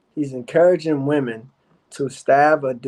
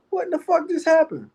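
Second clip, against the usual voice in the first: much higher, astonished retold outburst; total disbelief. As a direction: act out being completely floored. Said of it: "I said".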